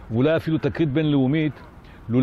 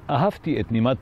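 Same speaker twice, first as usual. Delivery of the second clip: usual speaking voice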